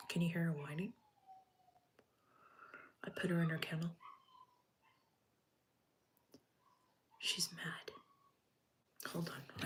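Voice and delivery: whispering so the dog settles down; hushed voiceless whisper to the viewers. Real, not acted. Dog whining in its kennel.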